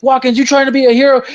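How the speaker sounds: high, fast soldier's challenge; quick, high-pitched movie-quote cry; urgent challenge. Retold or imitated. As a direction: imitate a heated battlefield demand. Acted battle argument from movie.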